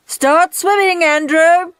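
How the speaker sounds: high, loud coach's yell across the pool; shouted order. A narrator's reading. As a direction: bark the order at the boy. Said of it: "shouted Soggy Sid".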